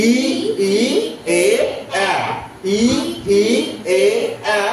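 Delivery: "ই, ই, এ, আ" as isolated pure vowels; measured chant of "ই, ই, এ, আ", gaps between; front vowel drill. Shown as a demonstration. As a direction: sound out each of "ই, ই, এ, আ" separately. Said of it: "front vowel কি বলবো".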